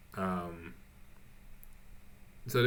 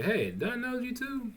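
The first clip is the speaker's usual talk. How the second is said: much higher and softer, a sly teasing lady voice; mock recognition; 13 semitones higher, 5 dB quieter than usual. Mail lady pretending to recognize Trevor, in a high, soft teasing voice. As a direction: lift the voice high and quiet, as if feigning recognition.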